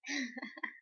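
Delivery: soft little giggle; real giggle. Real, not acted. Vlogger who laughs at whatever she touches, soft giggle. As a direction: giggle quietly to yourself. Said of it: "je rigole".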